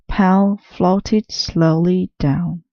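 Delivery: usual speaking voice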